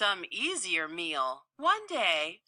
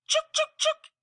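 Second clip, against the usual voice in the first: high chattering squirrel scold; sharp high squirrel chuks; 4 dB louder than usual. Acted. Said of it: "sprang into action".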